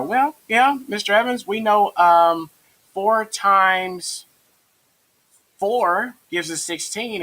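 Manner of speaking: high, slow imitation of a hesitant pupil; high-pitched student answering teacher. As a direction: play a kid working it out aloud, high and drawn out. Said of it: "your students kinda go".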